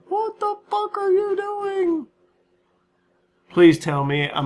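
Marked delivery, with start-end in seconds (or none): 0.1-2.1 much higher, slow squeaky mock shout; mock viewer yell. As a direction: imitate an exasperated viewer in a high squeak. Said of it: "screaming at me right now going".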